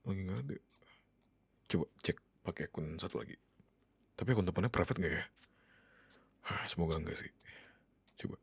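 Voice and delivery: soft, low self-talk; hushed muttering to oneself. Acted. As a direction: keep it very quiet while searching. Searching phone beside settled child. (bergumam pelan pada diri sendiri)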